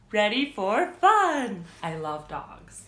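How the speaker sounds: plain reading voice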